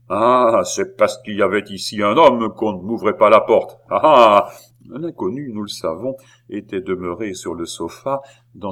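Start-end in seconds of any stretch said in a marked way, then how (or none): 0.1-4.5 loud, accusing outburst with long heavy "Ah"s; mounting angry tone. A narrator's reading. Beausire finds a man in the apartment, mounting anger. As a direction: build the jealous accusation at full volume. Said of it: "continuer sur un ton progressif".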